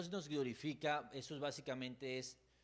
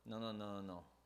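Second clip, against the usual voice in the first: normal talking voice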